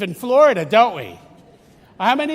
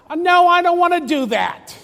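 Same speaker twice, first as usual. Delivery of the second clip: higher, louder whiny no from the son; sulky refusal; 8 semitones higher, 7 dB louder than usual. Retold or imitated. The elder son refusing his father, mock whiny. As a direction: whine it like a reluctant son.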